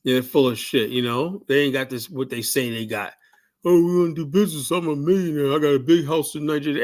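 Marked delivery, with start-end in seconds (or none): 3.6-6.8 lightly voiced bragging businessman, a bit higher; mock boastful stranger. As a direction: brag like a fake millionaire, tongue in cheek. Mocking boastful African stranger.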